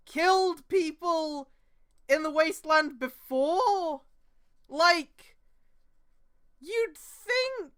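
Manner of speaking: high, loud disbelief, slowing down; incredulous rant. Real, not acted. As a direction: argue the point in disbelief. Incredulous rant about the story.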